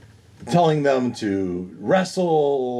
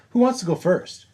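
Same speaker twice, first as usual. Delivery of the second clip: much higher, sing-out question; high-pitched call; 10 semitones higher than usual. Acted. Player voices asking the table who goes first, high call. Same question repeated, called out.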